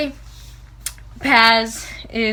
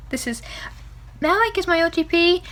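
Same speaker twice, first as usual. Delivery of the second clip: normal talking voice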